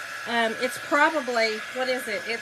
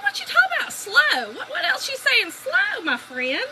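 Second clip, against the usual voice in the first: mock-indignant; 13 semitones higher than usual. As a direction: challenge him with exaggerated offence. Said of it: "Ooh, I was mad".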